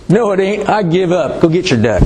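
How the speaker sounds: lightly voiced farmer delivering the punchline; Texas farmer. Old farmer gives up to lawyer, lightly played punchline. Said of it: "Farmer says".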